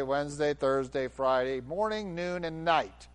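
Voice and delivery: ordinary delivery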